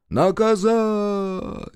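high, long-held chanted cry; crowd response. A narrator's reading. Crowd echoes the leader, high drawn-out cry. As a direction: hold the word high like a crowd. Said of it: "гаркнула в торе ему толпа".

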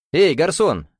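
high-pitched hail; calling out. A narrator's reading. Millionaire hails the waiter running past, calling out. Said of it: "окликнул он пробегавшего мимо официанта".

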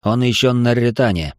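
ordinary delivery

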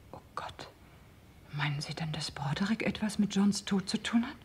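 whispered in sudden dread; frightened whisper. Acted. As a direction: gasp it out as the suspicion dawns. Sudden fear about Broderick.

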